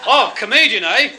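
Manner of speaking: high, loud mocking retort; bellowed sarcasm; sergeant's loud jibe. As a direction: shout it at the smart alec. Acted sergeant sneering.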